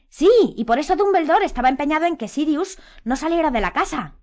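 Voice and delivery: high, loud, eager agreement; exclaiming. A narrator's reading. Harry jumps on Ron's idea, exclaiming. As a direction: seize on the idea loudly. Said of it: "exclamó Harry".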